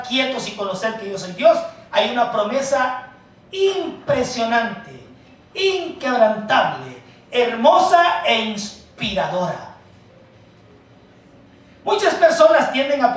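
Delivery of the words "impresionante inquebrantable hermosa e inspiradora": slow emphatic praise. Acted. Preacher savoring each adjective.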